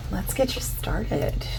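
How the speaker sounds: normal talking voice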